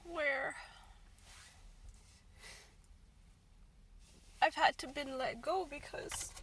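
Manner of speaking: real crying, words half whispered. Real, not acted. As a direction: fight through real tears. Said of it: "I'm crying now".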